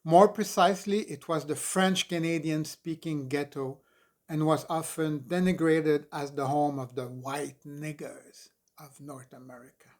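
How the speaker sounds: ordinary delivery, nothing marked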